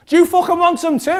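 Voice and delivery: loud, high retold threat; aggressive challenge. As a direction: act out a man squaring up. Angry man turns on him.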